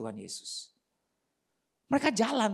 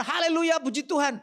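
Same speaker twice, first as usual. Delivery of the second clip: high, louder burst of praise; jubilant praise; 8 semitones higher, 4 dB louder than usual. Acted. A man praising God after winning the tender, jubilant. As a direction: celebrate with a raised hallelujah.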